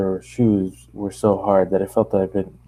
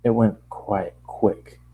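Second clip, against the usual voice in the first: usual speaking voice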